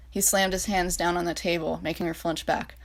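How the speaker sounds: ordinary delivery, nothing marked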